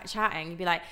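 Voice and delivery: normal talking voice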